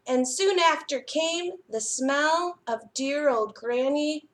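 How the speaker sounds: put-on lively storybook reading to children; picture book reading. A narrator's reading. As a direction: read it like a bedtime story for little ones.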